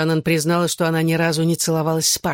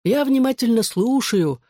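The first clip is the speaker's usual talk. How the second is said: slow, higher-pitched smug line; smirking; 7 semitones higher than usual. A narrator's reading. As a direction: draw it out high with a smirk. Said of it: "усмехнулся он". (с усмешкой, нараспев)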